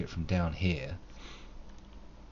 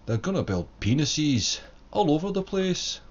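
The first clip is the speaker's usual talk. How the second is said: put-on a half-hearted Scottish accent, pitched up; lightly put-on Scottish brogue, a touch higher; weak Scottish accent; 7 semitones higher, 3 dB louder than usual. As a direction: try a Scottish accent and come up short. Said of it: "pretty weak Scottish accent".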